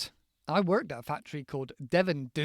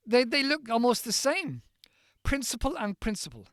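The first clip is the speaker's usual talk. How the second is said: ordinary talk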